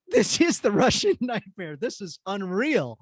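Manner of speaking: stunned and thrilled, words stretched out; amazed discovery. Retold or imitated. Retold amazed discovery of the podcaster.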